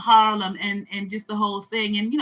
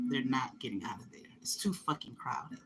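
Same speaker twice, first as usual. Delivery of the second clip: whisper; 7 semitones lower, 13 dB quieter than usual. Real, not acted. Whispering to the camera that Manhattan is too crowded.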